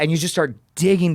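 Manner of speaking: ordinary delivery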